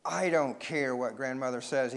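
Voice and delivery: ordinary delivery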